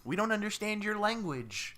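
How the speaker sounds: high puzzled alien. Retold or imitated. Alien buddies telling Dean, high baffled voice. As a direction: sound lost in our world.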